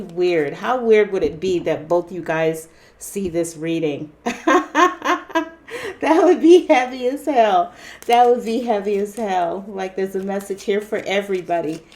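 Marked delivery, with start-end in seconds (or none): 4.2-7.7 louder, higher, said through laughter; laughing. Real, not acted. Laughing to viewers about a shared reading. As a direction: laugh it out in disbelief.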